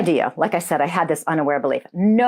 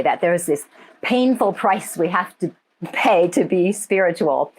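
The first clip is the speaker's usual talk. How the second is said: ordinary talk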